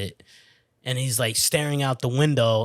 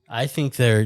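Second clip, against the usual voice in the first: usual speaking voice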